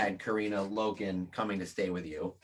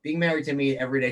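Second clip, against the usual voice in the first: usual speaking voice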